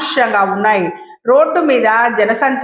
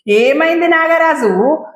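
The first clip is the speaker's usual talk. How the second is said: anxious, higher, slow question; worried; 6 semitones higher, slower than usual. A narrator's reading. Subbalakshmi asks Nagaraju, worried, what happened. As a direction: ask in a fluster if he's hurt. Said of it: "కంగారు పడుతూ అడిగింది".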